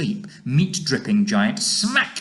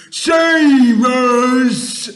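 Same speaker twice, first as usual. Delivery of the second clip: high, full-volume giant scream; 13 semitones higher, 13 dB louder than usual. A narrator's reading. Nightmare-struck giant pleading for rescue, screaming. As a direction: scream for help, drawn out. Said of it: "screamed the flesh lump eater".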